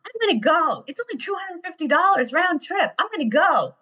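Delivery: high, excited voice of a son pitching a plan; imitated eager son. Son selling mom on a cheap Vietnam trip, imitated excitement.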